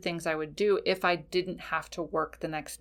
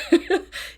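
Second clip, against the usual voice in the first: high giggle; 12 semitones higher, 5 dB louder than usual. Retold or imitated. Spirit guide laughing after message.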